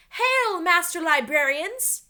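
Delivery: slow, loud, formal hail; Morgan's greeting. A narrator's reading. Morgan greeting the children from the window, slow and grand. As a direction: greet them like a queen.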